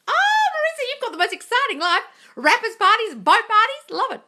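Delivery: high, loud mock gushing; a hammed-up, high-pitched coo at a fan's boast. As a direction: coo it like an impressed friend.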